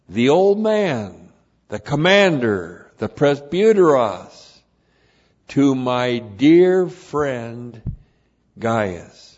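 emphatic preaching. Real, not acted. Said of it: "preaching emphasis".

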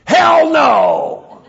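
high, full-force yell; wake-up shout. Shown as a demonstration. Preacher quoting a pastor's translation to jolt listeners, shouting. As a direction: bellow an outraged refusal. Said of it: "I just woke some of you up".

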